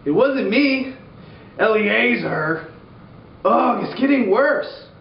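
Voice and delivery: high, whiny whispered protest; squeaky guy in the dark; high-pitched soldier voice. Retold or imitated. Mighty men whispering.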